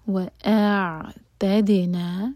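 وَأَعْتَدْنَا with a bounce on the silent د; slow وَأَعْتَدْنَا, letting the د bounce; bounced dal. Shown as a demonstration. Teacher repeats وَأَعْتَدْنَا slowly with the bounced dal. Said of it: "دال پر سکون تو دال bounce".

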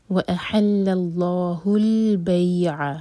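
وَأَحَلَّ اللَّهُ الْبَيْعَ with heavy lam of Allah; chanted, عین from the throat; tajweed recitation. Shown as a demonstration. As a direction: recite with full-mouthed لام in اللَّهُ. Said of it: "لام جلال heavy".

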